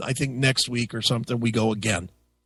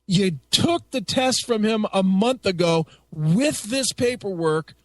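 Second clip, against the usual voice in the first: retold angry outburst, higher pitch; losing his temper; 7 semitones higher than usual. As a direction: indignantly tell off the clerk, drawing out key words. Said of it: "I fucking lost my goddamn mind".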